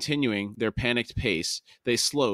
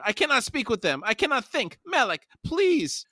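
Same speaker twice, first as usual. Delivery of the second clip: panicked high gibbering; Pormqual; 13 semitones higher than usual. A narrator's reading. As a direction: plead helplessly in a very high voice. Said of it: "Pormqual gibbered".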